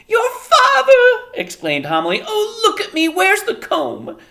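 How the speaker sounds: very high, loud, laughing-crying; flustered joy. A narrator's reading. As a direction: fluster at the news. Homily flustered.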